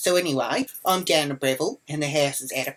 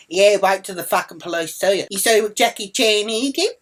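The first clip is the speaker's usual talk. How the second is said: loud, higher heated comeback; retold angry retort; 5 semitones higher, 6 dB louder than usual. Answering the woman filming her, angry retort. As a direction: fire back at her, louder.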